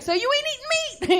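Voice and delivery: high, fast squawky mom voice; high mock-mom voice. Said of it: "She was like".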